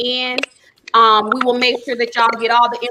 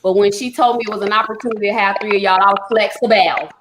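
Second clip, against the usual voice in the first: ordinary delivery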